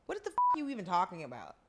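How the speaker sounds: usual speaking voice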